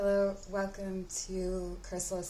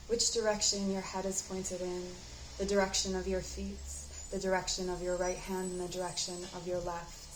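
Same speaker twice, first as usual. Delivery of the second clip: unhurried, even-toned meditation guide; guided meditation. Acted. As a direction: guide the listener gently inward, leaving space to breathe.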